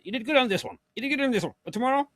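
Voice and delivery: high-pitched, patronizing mock approval; mock praise. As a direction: coo fake congratulations, pitched well up. Acting out fickle praise of Mehdi.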